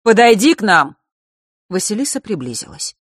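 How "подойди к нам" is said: calling out. A narrator's reading. Father calls her over.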